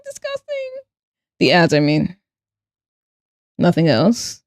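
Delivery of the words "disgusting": high breathy fade; soft disgusted. Pretend. Repeat fading to whisper.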